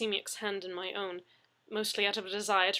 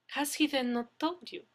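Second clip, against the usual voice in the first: demure Russian lady; 7 semitones higher, 4 dB louder than usual. Acted. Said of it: "inquired demurely".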